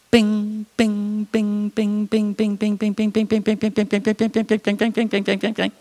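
high, rapid bing bing like balls bouncing on concrete; ping pong bounces. Retold or imitated. Teen dropping ping pong balls in the dark: rapid high bings. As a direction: tap out each bounce quickly.

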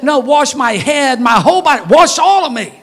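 building excited plea; eager plea. Retold or imitated. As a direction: Peter begging eagerly. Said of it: "He said".

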